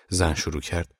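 plain reading voice

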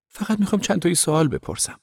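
high-pitched voice of an eager woman; pleading reporter. A narrator's reading. Reporter asks Ove for a few questions, high and pleading.

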